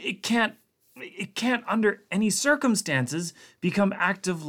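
high, pleading rush; frantic scientist. A narrator's reading. Blair urgently explaining to Connacht, frantic and high. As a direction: explain desperately before they act.

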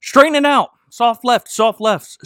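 loud, urgent calls to steer; urgent coaching. Acted. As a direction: guide the driver, half shouting. Sheriff coaching the driver.

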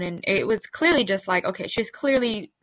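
usual speaking voice

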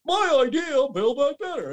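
high mocking Biden voice. Mocking Biden taking credit, high voice. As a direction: claim it proudly, mocking. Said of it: "Biden comes out and goes".

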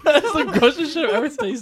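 laughing. Real, not acted. After gross bean.

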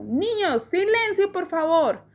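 voz de maestra, más aguda, pidiendo calma; maestra pidiendo silencio. A narrator's reading. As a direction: como la señorita Olivia mandando callar a la clase. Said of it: "la señorita Olivia dijo". (voz de maestra que pide silencio)

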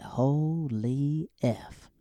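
drawn-out, hushed disbelief; a slow stunned mutter of disbelief; stunned aside. Retold or imitated. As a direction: let it slip out slowly in shock. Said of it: "I said".

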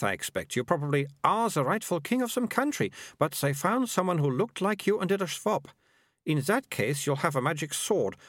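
put-on a bossy girl explaining things; prim girl. Melissa spins the prince story.

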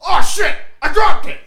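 high, hammed-up comic shout; mock panicked pilot. Pilot who released the bomb, mock panicked yell. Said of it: "The one dude goes".

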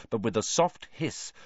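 ordinary delivery

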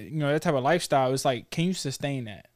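normal talking voice

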